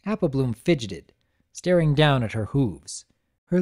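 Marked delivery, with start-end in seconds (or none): none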